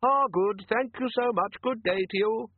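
polite tinny thing, pressing on; tinny polite machine. Acted. Device continues to Jackson, tinny and high. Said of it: "in a tinny yet polite voice".

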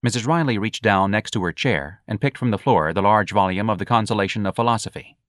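ordinary delivery

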